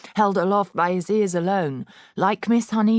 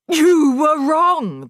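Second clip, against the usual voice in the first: much higher, louder, slow bark; 11 semitones higher, 9 dB louder, much slower than usual. A narrator's reading. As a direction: snap the verdict down at him. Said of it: "the Trunchbull barked".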